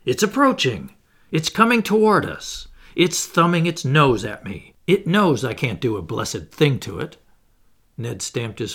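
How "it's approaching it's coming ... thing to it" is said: full of excitement. A narrator's reading. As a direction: call it out like a thwarted harpooner. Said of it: "his voice full of excitement".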